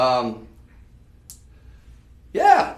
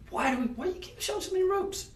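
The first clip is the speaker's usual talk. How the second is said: imagined viewer; 4 dB quieter, faster than usual. Retold or imitated. Voices an imagined viewer's question.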